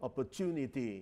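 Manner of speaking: "opportunity" said "oppor-chew-nity"; British pronunciation. Shown as a demonstration. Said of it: "คนอังกฤษจะออกเสียงว่า". (ออกเสียง "opportunity" แบบอังกฤษ ใช้เสียง จ แทน ท)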